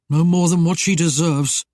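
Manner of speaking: usual speaking voice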